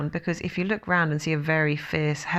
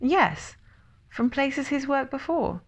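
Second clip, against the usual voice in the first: pointed explaining; 8 semitones higher than usual. A narrator's reading. Explaining impatiently to Piglet.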